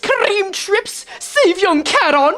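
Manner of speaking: high theatrical "verily" voice with a gasp; archaic knight voice. Acted.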